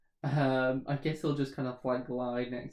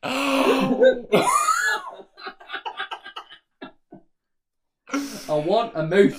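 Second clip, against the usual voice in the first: loud, high whining request; whiny plea; 10 semitones higher, 9 dB louder, slower than usual. Acted. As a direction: whine like a kid. Begs to swap pick.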